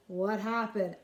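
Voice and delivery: plain reading voice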